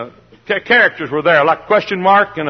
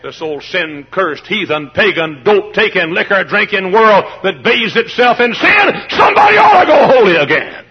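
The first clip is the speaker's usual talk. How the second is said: revival preaching; 4 semitones higher, 4 dB louder than usual. Acted. Preacher railing against a sinful world, climbing to a shout. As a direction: pile up the insults and explode at the end.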